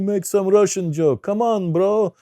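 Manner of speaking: put-on loud, high heckle in a Russian accent; slow-starting, shouted Russian-accented demand; Russian heckler. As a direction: egg the comic on as a Russian audience member. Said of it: "Russian people give me a hard time".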